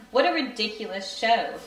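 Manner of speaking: usual speaking voice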